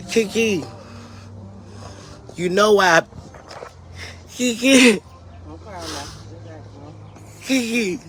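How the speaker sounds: high, strained heartfelt thanks; emotional, tearful. Real, not acted. Thanking Kiki for her help, emotional. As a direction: call out to a dear friend, choking up.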